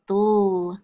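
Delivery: DULL as a drawn-out "dul" ending; isolated syllable sound. Shown as a demonstration. Teacher drilling sound cards, DULL as a held syllable. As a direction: hold the end sound of candle, DULL. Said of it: "do our letter sound cards now".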